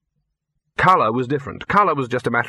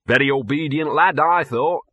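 smug; 4 semitones higher, 3 dB louder than usual. A narrator's reading. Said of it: "said Gaspode smugly".

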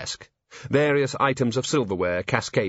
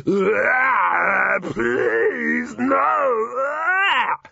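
shouting, crying in terror; anguished cry; 11 semitones higher, 4 dB louder, much slower than usual. A narrator's reading. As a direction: scream and beg in agony.

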